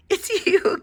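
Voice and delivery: giggling. A narrator's reading. Eddie laughs at the silly bear, giggling. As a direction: giggle through it. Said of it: "giggled Eddie".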